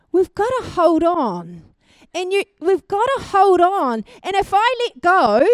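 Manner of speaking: rally the congregation to hold on tight; preaching with growing force; fervent preaching. Acted. Preaching with a fireman's grip demo.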